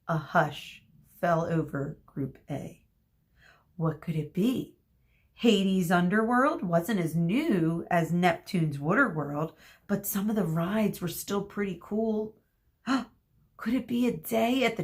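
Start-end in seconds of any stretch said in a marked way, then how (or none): none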